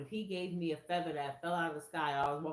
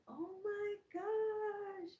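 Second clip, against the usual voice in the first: much higher, slow and drawn out; stunned awe; much slower than usual. Retold or imitated. Seeing her own shawl's feathers, slow and stunned. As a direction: let it sink in slowly.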